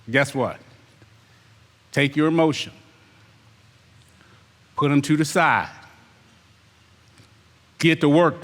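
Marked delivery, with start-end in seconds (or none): none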